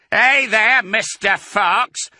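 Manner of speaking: high, loud taunting call; drawn-out holler to the enemy; yelled. A narrator's reading. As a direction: yell it from the tractor, stretching the name. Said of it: "yelled Bunce".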